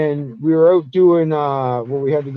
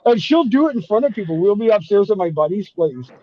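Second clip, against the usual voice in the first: ordinary talk, nothing marked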